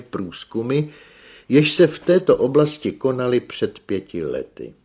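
loud, high-pitched formal presentation; pompous expert's report; learned young engineer lecturing. A narrator's reading. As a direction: lecture forcefully to a gathered audience.

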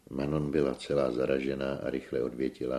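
plain reading voice

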